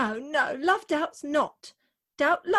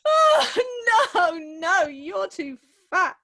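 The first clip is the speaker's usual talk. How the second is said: sobbing outburst; 3 semitones higher, 5 dB louder, slower than usual. Acted. Bride sobbing as she turns down the old wedding dress, tearful outburst. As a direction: blurt it out through sobs.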